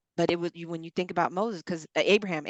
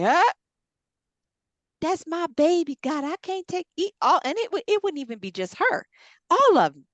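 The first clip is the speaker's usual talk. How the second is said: high, loud plea to God; anguished protest; 10 semitones higher, 6 dB louder than usual. Acted. Woman to God about giving up her baby, anguished protest. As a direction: cry out in disbelief at being asked.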